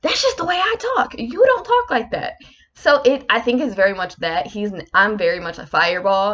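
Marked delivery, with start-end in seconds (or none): 0.0-2.3 very high mock protest; high-pitched, acted-out bickering; defensive retort. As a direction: plead your case in a high, put-out voice. Her defensive reply in acted quarrel.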